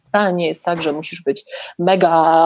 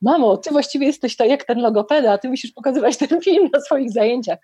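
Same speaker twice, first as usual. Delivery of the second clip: imitating daughter; 5 semitones higher than usual. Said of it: "mówiła".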